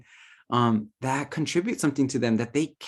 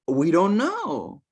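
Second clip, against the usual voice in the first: higher and slow, bewildered; flustered guides; 8 semitones higher, 3 dB louder, slower than usual. Retold or imitated. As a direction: stall like a crowd caught out. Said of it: "these fools are gonna scramble".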